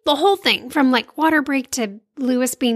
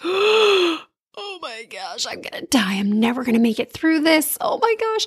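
mock panic; 3 semitones higher than usual. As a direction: play up the horror, pitch swooping high. Acting out her panicked reaction.

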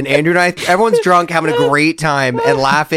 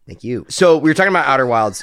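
ordinary talk

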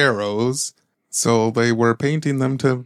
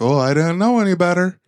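higher, put-on innocent voice; mock naive buyer; 6 semitones higher than usual. Fooled buyer in Harper Woods, mock naive and higher. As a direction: play dumb like a fooled buyer. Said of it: "being like".

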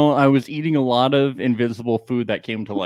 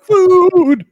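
hammed-up shriek, much higher and louder; mock scream; 14 semitones higher, 6 dB louder than usual. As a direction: shriek like seeing a monster.

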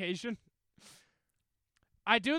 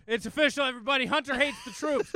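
rally the crowd in a put-on southern twang; mock southern accent; 8 semitones higher, 6 dB louder than usual. To the podcast crowd, mocking his friend in a loud southern accent. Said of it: "Why are you going southern?".

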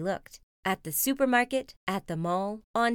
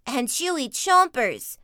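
put-on loud, slow, higher boy whine; whining brother bear; 5 semitones higher, 7 dB louder than usual. Brother begging at the checkout, loud drawn-out whine. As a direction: whine it loudly like a cub. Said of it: "whined Brother".

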